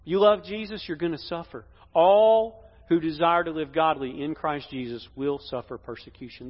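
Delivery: normal talking voice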